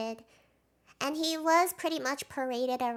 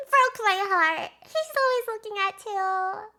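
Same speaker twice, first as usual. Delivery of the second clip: emotional fan gush; 6 semitones higher, 6 dB louder than usual. Real, not acted. Emotional fan reacting to video.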